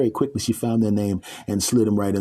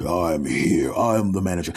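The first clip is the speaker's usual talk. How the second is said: lower, calm God voice; 4 semitones lower than usual. Acted. God stepping in as manager, calm.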